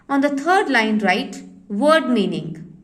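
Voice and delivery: ordinary talk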